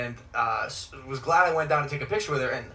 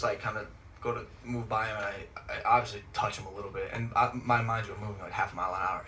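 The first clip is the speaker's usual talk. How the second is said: ordinary talk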